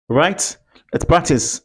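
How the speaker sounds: ordinary talk, nothing marked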